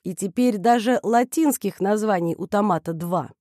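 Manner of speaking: usual speaking voice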